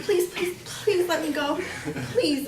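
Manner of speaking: high, repeated begging; re-enacted pleading. Victim to gunman, re-enacted high pleading.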